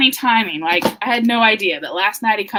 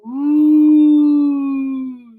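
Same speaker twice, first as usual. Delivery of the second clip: loud drawn-out ooh; frog ooh; 2 semitones higher, 5 dB louder than usual. Acted. Frog reacts to the contest, loud ooh. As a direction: coo it out like an impressed frog. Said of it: "says the frog".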